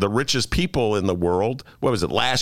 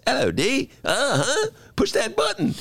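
high, playful British lilt climbing up; Fleabag British voice. Retold or imitated. Host imitates Fleabag characters to his co-host, high British. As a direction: play it posh and chirpy British. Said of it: "they all talk like this".

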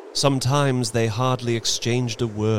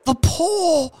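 very high, loud shriek; wild cry; 5 dB louder than usual. A narrator's reading. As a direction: cry out in sudden frenzy. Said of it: "she cried wildly".

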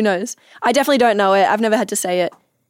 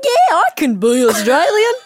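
broad Australian, loud, high and drawn out; hammed-up slow Australian accent; exaggerated Australian accent; 8 semitones higher, 5 dB louder than usual. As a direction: overdo the Australian twang eagerly. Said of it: "It's, like, way more Australian".

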